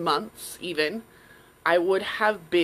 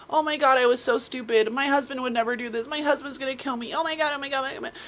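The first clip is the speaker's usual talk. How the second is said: mock panic; 7 semitones higher than usual. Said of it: "then I would blame myself".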